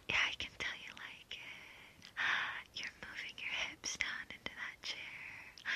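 soft whisper, close to the listener; intimate whisper. Acted. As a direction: drop to a hushed whisper. Intimate roleplay, murmured to listener.

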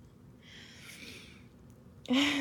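breathy, embarrassed giggle; shy laugh. Real, not acted. Girlfriend overcome with feeling, bashful laughing. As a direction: laugh off the emotion.

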